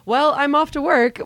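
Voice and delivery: sing-song casual goodbye, pitched high; Chelsea's breezy exit line. Retold or imitated. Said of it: "and then Chelsea just goes".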